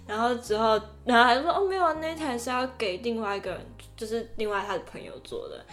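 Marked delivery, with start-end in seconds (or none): none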